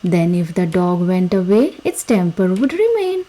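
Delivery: ordinary delivery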